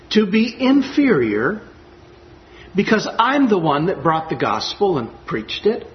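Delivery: usual speaking voice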